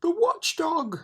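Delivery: high, loud panicked cry; frightened shout. Acted. Lethargarian warning the others, high frightened shout. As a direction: cry out the name in terror. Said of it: "shouted another".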